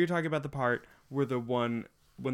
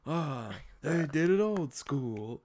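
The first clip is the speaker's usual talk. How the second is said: put-on slow, drawn-out old man voice; quoted old men; 3 semitones higher, much slower than usual. Quoting the old men at the end, slow old-man voice. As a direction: stretch it out like a nostalgic old man.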